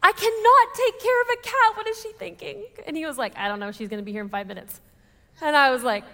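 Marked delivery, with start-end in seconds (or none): 0.0-1.8 high, loud, hammed-up meltdown; retold hormonal outburst. New mother refusing a surprise cat, hammed-up frantic outburst. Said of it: "came out in a really ugly way". 1.8-2.6 high, softer exasperated wail; retold exasperation. New mother questioning her own mother's sense, high mock exasperation. Acted end of tearful outburst. 3.4-4.8 low, softer imitation of husband; retold meek husband. Husband answering his upset wife, low and meek.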